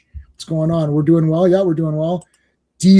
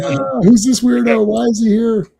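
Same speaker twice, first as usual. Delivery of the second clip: higher mock-alarmed baby voice; startled baby; 5 semitones higher than usual. Baby sees dad without beard, high mock alarm. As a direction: act a baby baffled by a stranger. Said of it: "the babies have no idea who they are".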